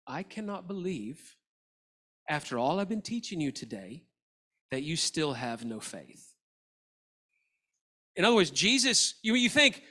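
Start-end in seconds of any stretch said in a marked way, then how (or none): 0.1-6.3 soft, low, measured reproach; acted disappointment, sinking lower and softer; quiet rebuke. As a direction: rebuke them in a hushed, disappointed tone. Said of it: "He's rebuking them for having little faith".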